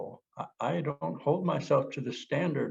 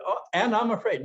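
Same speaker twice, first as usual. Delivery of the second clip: high, louder frightened cry; mock fear; 11 semitones higher, 5 dB louder than usual. To himself, naming his fear in a high frightened cry. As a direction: play the scared voice of old fear.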